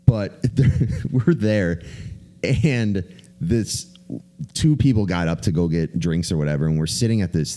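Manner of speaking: normal talking voice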